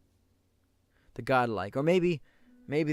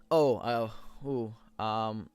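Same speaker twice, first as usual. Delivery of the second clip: ordinary talk, nothing marked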